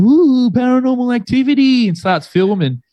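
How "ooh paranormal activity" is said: high, breathy ooh like a ghost-show host; mock spooky excitement. Mate filming the door noise, mock spooky excitement. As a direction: ham it up as if filming a ghost hunt. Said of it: "he's like".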